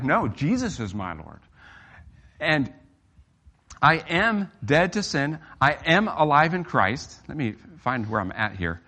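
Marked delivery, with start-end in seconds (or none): 0.0-7.2 slow, weighty words of faith, each one pressed; slow emphatic declaration. Shown as a demonstration. Preacher shows the church how to answer sin, slow and emphatic. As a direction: declare it firmly, word by word. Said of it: "And so you're like".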